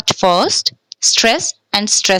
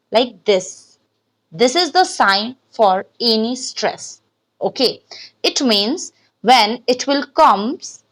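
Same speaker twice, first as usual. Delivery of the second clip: ordinary delivery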